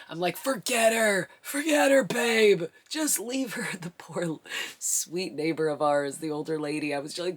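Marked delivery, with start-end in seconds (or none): 0.4-3.7 loud, high, desperate order; frantic yell. Retold or imitated. Laboring wife yelling at husband to forget the neighbor. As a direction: bark at him to drop it and come.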